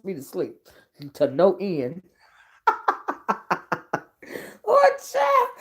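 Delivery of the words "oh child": a high, wailing laugh over the words; laughing cry. Real, not acted. A vlogger overcome with giggles crying out high. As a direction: squeal it out between laughs.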